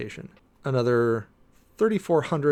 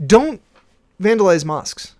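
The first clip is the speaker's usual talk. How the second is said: scolding shout; 6 semitones higher, 10 dB louder than usual. Real, not acted. Scolding the audience after stats.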